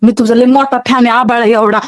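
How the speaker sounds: ordinary talk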